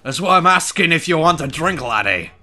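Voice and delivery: loud mock Scottish accent; put-on Scottish accent. Streamer slipping from Irish into loud mock Scottish while offering a drink. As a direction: lay on a broad Scottish accent, loudly. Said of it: "Where's that Scottish?".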